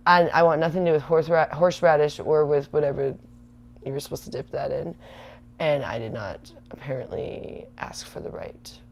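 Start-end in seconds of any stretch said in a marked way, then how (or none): 5.6-8.8 soft, breathy whispered confession; whispered aside. Real, not acted. Whispered aside about steak.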